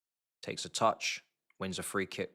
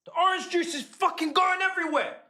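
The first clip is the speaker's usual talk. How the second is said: angry shouting; 9 semitones higher than usual. Real, not acted. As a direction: yell at the screen in outrage. Protesting foul, spilling juice.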